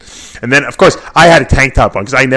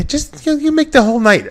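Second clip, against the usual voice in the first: pleading encouragement. Retold or imitated. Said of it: "I said".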